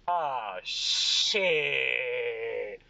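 put-on long drawn-out drunken realization; Craig. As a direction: let the curse hiss out slowly. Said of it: "and he goes".

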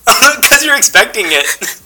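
slow, laughing high answer. Real, not acted. Laughing at a tickling question.

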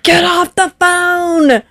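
high, slow, exasperated command; hammed-up snap at her husband; telling husband on the phone to hang up, exasperated; mock angry order. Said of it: "And I'm like".

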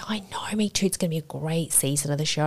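soft, near-whispered chatter; hushed clueless reply. Retold or imitated. Answering friends about the TV show, soft hushed imitation. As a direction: murmur it, missing the point.

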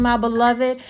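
normal talking voice

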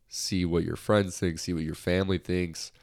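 ordinary talk

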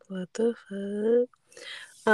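slow, breathy whispered what the fuck; drawn out in hushed disbelief. Retold or imitated. Friend retelling scam text, whispered slow shock.

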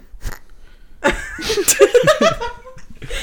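shrieking giggle fit; high squealing laugh. Real, not acted. Laugh at Google question.

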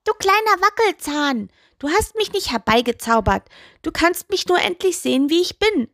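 high-pitched, exasperated toe voice; annoyed. A narrator's reading. Toe sets the witch straight about the spell, annoyed. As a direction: scold in a high, irritated character voice. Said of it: "sagte der Zeh genervt".

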